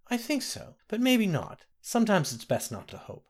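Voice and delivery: a hint of a kindly old woman; old nursemaid. Ramke voiced old nursemaid.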